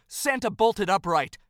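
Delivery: plain reading voice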